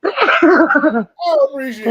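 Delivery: high, breaking into laughter; laughing through words. Real, not acted. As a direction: try to keep a straight face, failing. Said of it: "I'm trying not to laugh".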